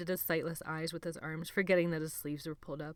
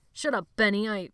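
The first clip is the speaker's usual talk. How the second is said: loud, sharp cut-off; snapping; 4 semitones higher, 8 dB louder than usual. A narrator's reading. Sebastian silencing Benny, loud and sharp.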